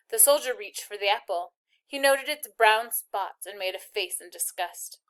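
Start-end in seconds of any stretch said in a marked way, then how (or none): none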